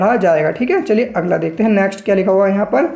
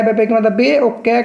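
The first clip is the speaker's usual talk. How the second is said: normal talking voice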